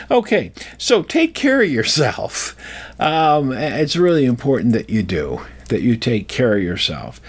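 ordinary delivery

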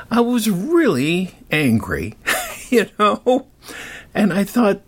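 ordinary delivery

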